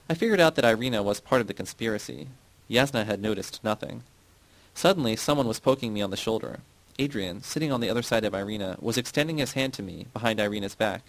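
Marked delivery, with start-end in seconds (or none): none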